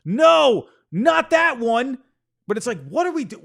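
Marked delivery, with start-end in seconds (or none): 0.1-2.0 high, much louder anguished no, dragged slow; mock scream. Reading the second meme caption, mock anguished scream. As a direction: howl it like the crying meme. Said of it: "screaming and crying".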